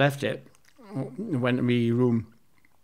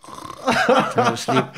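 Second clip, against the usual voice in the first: breaking into real laughter, higher and slow; laughing; 11 semitones higher, 5 dB louder, much slower than usual. Real, not acted. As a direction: laugh through the words as you confess. Admitting he was caught out.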